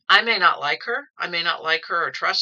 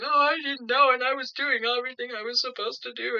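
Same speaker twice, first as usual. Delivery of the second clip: high, whiny imitation of a defendant; a whining imitation of someone crying in court; mock crying plea; 7 semitones higher than usual. Woman crying in front of the judge, mock whiny plea. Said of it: "she just starts crying".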